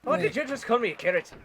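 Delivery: quick attempt at a Welsh lilt; Welsh accent. Shown as a demonstration. Answering a friend's carrot insult in an attempted Welsh accent. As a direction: fire it back in a makeshift Welsh accent. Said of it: "say it with a Welsh accent".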